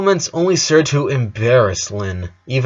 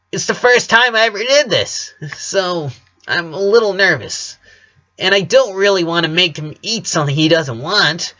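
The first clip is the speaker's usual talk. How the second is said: nervous; 7 semitones higher than usual. A narrator's reading. Lynn nervous, tight.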